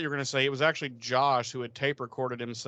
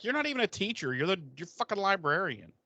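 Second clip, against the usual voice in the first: higher sneering student voice; mock students; 7 semitones higher than usual. Students mocking Giles the librarian, higher sneering tone. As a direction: scoff at him, pitched up. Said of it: "they're like".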